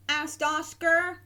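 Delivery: ordinary delivery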